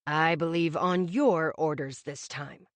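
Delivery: hint of a measured older father; father's voice. A narrator's reading. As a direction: voice the father with easy dry calm. Augie's pointed remark.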